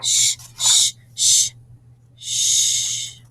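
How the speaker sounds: "sh" hissed loud, again and again; showing the class the "sh" in "brush"; sh sound. Shown as a demonstration. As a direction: hiss the "sh" sound. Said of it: "S and H".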